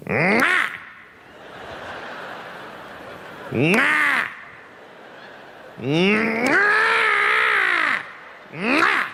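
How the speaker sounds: high, rising nonsense yelp; repeated "mwah" noise. Shown as a demonstration. Lone passenger sitting on a plane, high disruptive noises. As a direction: blurt high swooping "mwah" noises, one held long. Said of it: "you just start going".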